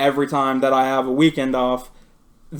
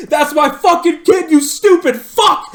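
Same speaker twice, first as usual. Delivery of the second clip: furious retold scream, higher and louder; screaming at boss; 12 semitones higher, 7 dB louder than usual. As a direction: yell it at the boss, full rage. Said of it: "screamed at him".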